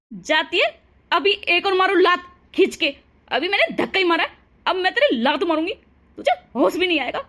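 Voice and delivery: loud, hard shouting mother-in-law; mother-in-law shouting at the bride; furious accusation. Acted. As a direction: hurl the accusation at her.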